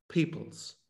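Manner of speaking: conspiratorial whisper; whispered punchline. Retold or imitated. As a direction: drop to a whisper on the last word. Joking punchline of retold answer.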